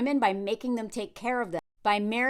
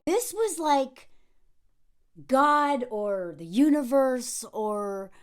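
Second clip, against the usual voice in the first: normal talking voice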